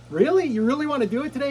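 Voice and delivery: high, incredulous question; can't believe she asked; surprised. Retold or imitated. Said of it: "I was like".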